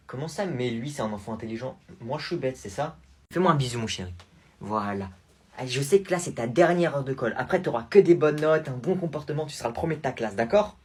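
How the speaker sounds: ordinary delivery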